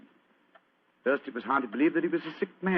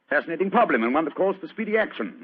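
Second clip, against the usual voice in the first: excited; 6 semitones higher, 6 dB louder than usual. Acted. Holmes excited by the case.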